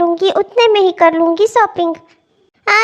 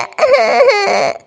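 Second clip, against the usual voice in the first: short sob. Acted. Crying to God.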